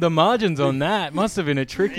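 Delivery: high mock-sarcastic quip. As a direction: say it with loud fake sympathy. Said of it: "I was gonna say".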